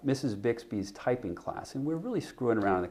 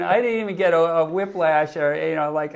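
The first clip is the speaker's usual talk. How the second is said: usual speaking voice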